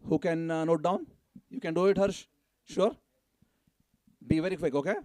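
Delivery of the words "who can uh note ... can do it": ordinary talk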